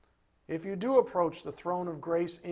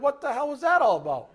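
loud, higher indignant what the hell; indignant outburst; 7 semitones higher, 6 dB louder, faster than usual. Retold or imitated. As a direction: call out the intruder, outraged. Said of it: "I'm gonna be like".

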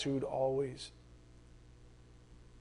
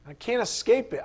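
ordinary talk, nothing marked

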